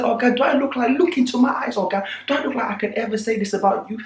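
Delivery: high pleading voice, laughing through it; mock high plea with a laugh; pleading Farouk. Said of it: "He said".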